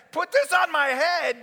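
high, loud, slow outburst; acted exasperated shout; incredulous echo. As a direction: throw the order back in outrage. Patient throws order back.

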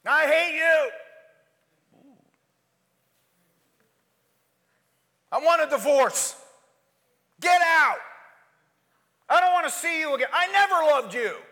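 loud, high furious yelling at a spouse; mock marriage blowup, shouted; angry outburst. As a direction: hurl the words like a fight at home. Acted angry marital outburst quoted.

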